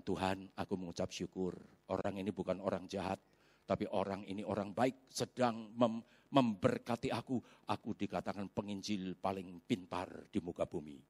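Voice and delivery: low, soft, humble prayer; inner prayer. Retold or imitated. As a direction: murmur a thankful prayer to God. Silent prayer in his heart. (doa dalam hati, pelan dan rendah)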